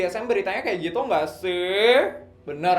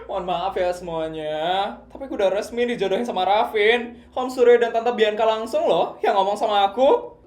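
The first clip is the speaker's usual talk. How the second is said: ordinary delivery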